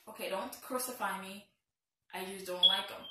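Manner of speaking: usual speaking voice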